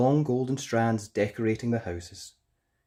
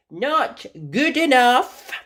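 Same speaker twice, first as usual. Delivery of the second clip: Rapunzel refusing; 12 semitones higher, 9 dB louder, slower than usual. Acted.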